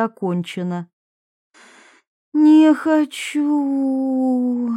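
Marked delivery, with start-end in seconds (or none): 2.3-4.7 higher, very drawn-out drowsy whine; sleepy protest. A narrator's reading. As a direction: whine it slowly, half asleep. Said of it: "пробормотала она, всё же выплывая".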